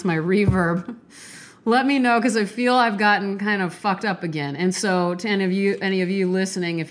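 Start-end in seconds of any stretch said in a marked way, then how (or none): none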